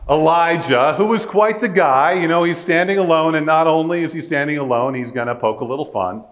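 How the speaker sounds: normal talking voice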